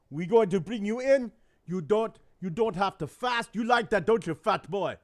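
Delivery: imitating his doctor's Caribbean accent. Retelling his doctor's promise of a buffet, in a Caribbean accent. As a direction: play the doctor with a Caribbean accent that drifts toward Austrian. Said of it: "Caribbean accent to Austrian".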